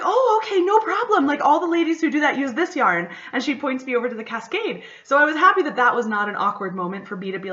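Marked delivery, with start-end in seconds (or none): 0.0-3.1 much higher, louder perky reply; eager shopkeeper. Retold or imitated. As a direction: play the overeager helpful shop lady. Shop owner answers eagerly.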